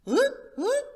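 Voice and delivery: very high, loud bird call; the pheasant waking up; pheasant hoot. Acted. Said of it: "said the pheasant".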